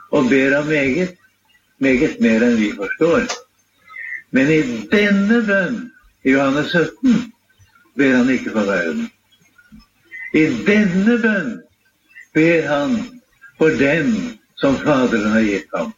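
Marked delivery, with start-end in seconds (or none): 4.5-5.8 «denne» drawn out and pitched high; stress on DENNE. Real, not acted. Preacher contrasts this prayer with others. 10.3-11.6 «denne» stretched high, then falling; slow down and lift «denne» well up; stress on DENNE. Real, not acted. Preacher repeats the limiting point.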